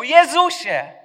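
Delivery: high, loud desperate shout. Acted. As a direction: scream his name with all your strength. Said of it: "a potem krzyczał". (głośny, wysoki, rozpaczliwy krzyk)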